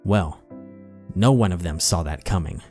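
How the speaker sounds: ordinary delivery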